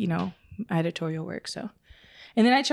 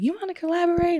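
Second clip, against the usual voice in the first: much higher, eager mock invite; sweet-talking caller; 10 semitones higher, faster than usual. Mimicking people who now want to collaborate, high and eager. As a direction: play the sudden friendly ask.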